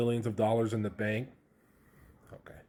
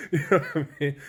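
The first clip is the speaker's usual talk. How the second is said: loud laughter; 7 semitones higher, 5 dB louder, faster than usual. Real, not acted. Laughing at the severance.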